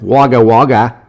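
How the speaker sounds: punchy put-on Aussie accent; Australian accent. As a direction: play a proud Australian local, accent laid on. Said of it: "in Australia with that accent".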